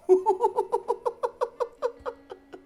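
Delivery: very high "ju ju ju" laugh. Shown as a demonstration. Preacher demonstrating a laugh on the vowel U, very high. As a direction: hoot a laugh on U. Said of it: "Y otros con la U".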